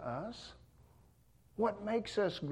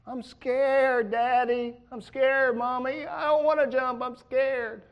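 put-on much higher, louder, whiny little kid afraid to jump; scared child; 9 semitones higher, 5 dB louder, slower than usual. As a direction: plead like a frightened small child at the pool. Frightened child at pool edge.

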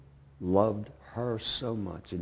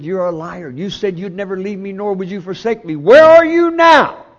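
anguished yell; 12 semitones higher, 17 dB louder than usual. Retold or imitated. Preacher reliving crying out to God in the hospital, anguished yell. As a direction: scream it to heaven in despair. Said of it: "I was yelling".

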